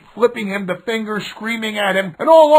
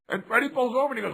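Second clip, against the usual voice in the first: ordinary talk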